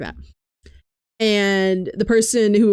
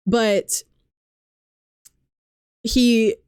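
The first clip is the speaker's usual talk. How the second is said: ordinary delivery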